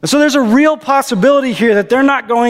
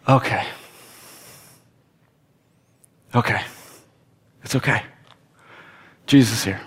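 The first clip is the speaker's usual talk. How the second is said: hushed relief; 9 semitones lower, 7 dB quieter than usual. Retold or imitated. Disciples feeling peace as Jesus arrives, hushed relief. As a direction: breathe it out quietly.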